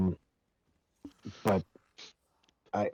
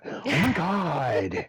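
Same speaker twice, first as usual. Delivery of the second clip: high, very drawn-out mock wail; bad Annoyed impersonation; 9 semitones higher, 4 dB louder, much slower than usual. Friend retries impersonating a teammate's explosion reaction, long high wail. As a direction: stretch it out in a hammed-up high cry. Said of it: "That was bad impersonation".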